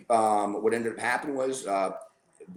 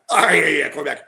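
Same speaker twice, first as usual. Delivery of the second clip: laughing mock brush-off, louder; dismissive laughing; 4 semitones higher, 8 dB louder, faster than usual. Repeating his brush-off to the podcast host, laughing. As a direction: shrug it off through a laugh.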